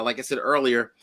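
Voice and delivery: usual speaking voice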